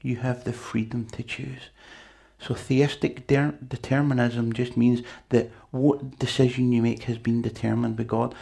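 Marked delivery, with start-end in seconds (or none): none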